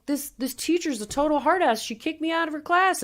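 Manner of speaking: high-pitched complaining voice; Billy Madison. Acted. Billy telling the housekeeper about his teacher, high complaint. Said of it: "He's like".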